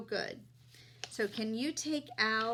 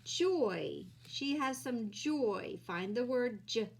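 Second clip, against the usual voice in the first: ordinary talk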